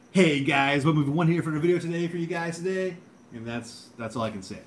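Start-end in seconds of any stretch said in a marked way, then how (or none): 0.1-3.0 loud, higher vlogger greeting; hammed-up YouTuber intro, pitched up and held; movie one. Greeting video viewers, loud high movie one impression. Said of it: "movie one impression".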